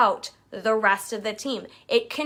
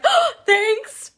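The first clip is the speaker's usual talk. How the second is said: much higher, louder, giddy thank-you; excited thanks; 12 semitones higher, 7 dB louder than usual. Real, not acted. Coach reacting to a compliment, giddy. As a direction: squeal it like praise made your day. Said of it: "I get so excited".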